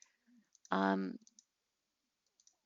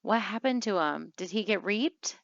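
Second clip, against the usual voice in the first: usual speaking voice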